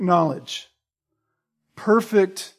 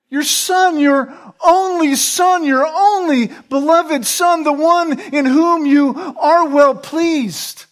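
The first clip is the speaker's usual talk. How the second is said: impassioned preaching; 7 semitones higher, slower than usual. Acted. Preacher to congregation on the cross, fervent crescendo. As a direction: build it up like a pulpit climax.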